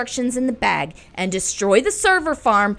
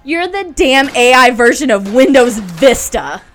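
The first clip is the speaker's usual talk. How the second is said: loud taunt; 10 dB louder than usual. Acted. Taunting the villain in battle.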